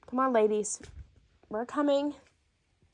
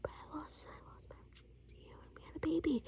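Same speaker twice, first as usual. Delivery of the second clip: fast secret whisper; 21 dB quieter, faster than usual. Real, not acted. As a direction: whisper it quickly so nobody hears. Confessing secret to husband.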